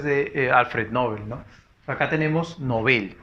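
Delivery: usual speaking voice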